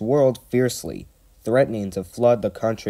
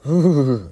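high, loud shivery "hoo-hoo-hoo"; acted teeth-chattering cold cry; shivering noise; 5 semitones higher, 6 dB louder than usual. A narrator's reading. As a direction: shudder aloud as if freezing. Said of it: "He shivered".

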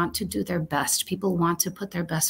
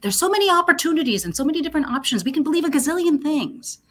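excited; 10 semitones higher, 5 dB louder than usual. Real, not acted. Excited about beliefs.

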